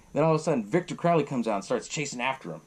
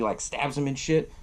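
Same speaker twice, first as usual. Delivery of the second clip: ordinary talk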